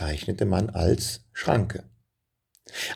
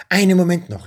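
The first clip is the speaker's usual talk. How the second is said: high, loud call; 11 semitones higher, 5 dB louder, faster than usual. A narrator's reading. Benjamin calls out before running to his friends, loud. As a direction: call out to stop them, much higher. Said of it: "rief Benjamin aus".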